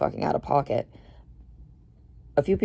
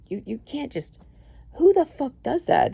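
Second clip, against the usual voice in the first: outraged yell; 11 semitones higher than usual. Retold or imitated. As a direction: demand it in disbelief, voice shooting up. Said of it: "was like".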